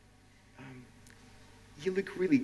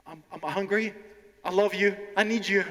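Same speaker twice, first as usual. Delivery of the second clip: imitating a nervous learner, pitched up; 6 semitones higher than usual. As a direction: blurt it out like a nervous student, higher voice. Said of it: "one lady was so nervous".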